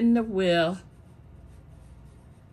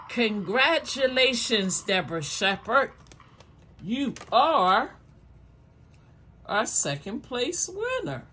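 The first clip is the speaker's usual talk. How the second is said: hyped giveaway announcement; 6 semitones higher than usual. Real, not acted. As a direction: build suspense, then announce the winner. Announcing second place winner.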